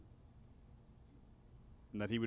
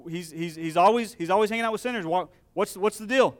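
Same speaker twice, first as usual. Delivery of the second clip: higher, louder indignant Pharisee whining; mock grumbling; 5 semitones higher, 7 dB louder than usual. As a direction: mimic a scandalized gossip. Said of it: "Remember what they're saying".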